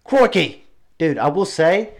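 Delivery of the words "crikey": high, loud mock Aussie voice; bad Australian accent, loud and high; terrible Australian accent. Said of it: "it's my terrible Australian accent".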